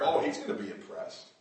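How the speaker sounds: usual speaking voice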